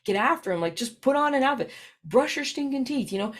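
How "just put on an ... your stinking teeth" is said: mock scolding parent, pitched higher; nagging a kid to get dressed; frustrated parent. Parent snapping at kids to get ready, mock scolding.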